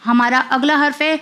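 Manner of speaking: usual speaking voice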